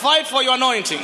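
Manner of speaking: preaching at full force. Acted. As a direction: call each person to fight. Calling out to congregants.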